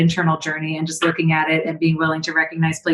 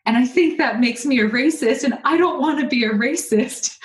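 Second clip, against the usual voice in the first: put-on child's worried confession; 7 semitones higher than usual. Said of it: "And I said".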